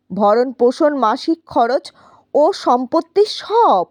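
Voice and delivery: usual speaking voice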